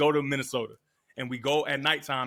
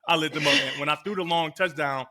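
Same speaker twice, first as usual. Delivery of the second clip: ordinary talk, nothing marked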